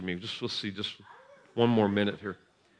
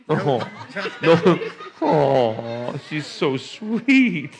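tender laugh; 5 semitones higher, 4 dB louder, much slower than usual. Real, not acted. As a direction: melt over her. Doting, laughing.

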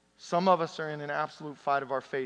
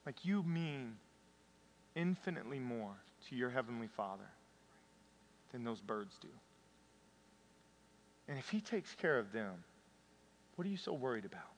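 hushed, softer tender reassurance; tender Jesus; 3 semitones lower, 7 dB quieter than usual. Retold or imitated. Jesus kneeling to a crying believer, tender and hushed. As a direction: kneel and comfort a crying child. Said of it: "imagine Jesus just getting down on his knee".

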